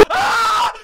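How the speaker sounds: startled scream; sudden terrified yell; hit hard crying out. Real, not acted. Jump scare in horror game. (びっくりして叫ぶ)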